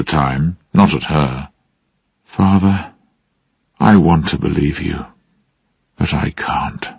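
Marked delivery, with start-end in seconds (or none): 2.2-6.9 soft, lowered voice fading to a whisper; softly. A narrator's reading. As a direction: confess it gently, trailing into breath. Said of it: "she said softly".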